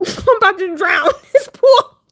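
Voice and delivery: high, loud words through laughter; real laughter breaking into a mock cry; laughing wail. Real, not acted. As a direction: crack up while lamenting your doom. Jokes about nearly drowning, cracking up.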